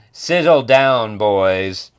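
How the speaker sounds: put-on loud, slow order to the boys; mock commanding. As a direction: draw it out loudly to settle them down. Samurai acted telling boys off.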